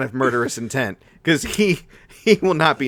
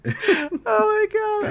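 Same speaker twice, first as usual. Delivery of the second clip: high, slow delighted squeal; stretched squeal; much slower than usual. Real, not acted. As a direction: draw out the delight. Fan excitement.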